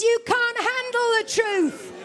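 lightly voiced movie tough guy, slow and deliberate; a hint of a film-star growl, each word weighed; slow mock movie line, lightly imitated; Jack Nicholson. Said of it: "let's get back to Jack Nicholson".

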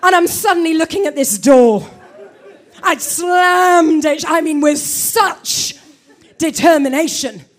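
loud, slow, and punching each word; loud angry slow telling; loud slam. Retold or imitated. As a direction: relive the furious slam out loud. Slamming the door in anger.

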